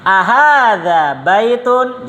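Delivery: teacher saying Ma hadza for the class to echo; teacher drill. Shown as a demonstration. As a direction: model the phrase slowly for repeating.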